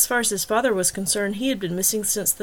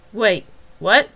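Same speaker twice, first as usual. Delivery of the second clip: louder sharp what, then slower; sudden surprise; 7 dB louder than usual. Acted. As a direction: caught off guard, then pressing. Detective catches a slip.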